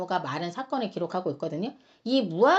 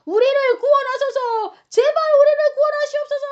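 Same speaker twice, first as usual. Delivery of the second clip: pleading cry; 14 semitones higher, 8 dB louder than usual. Acted. Crowds begging Jesus to save them, earnest pleading shout. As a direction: shout a desperate plea to be saved. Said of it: "그들의 간절한 간구의 외침이었던 거죠".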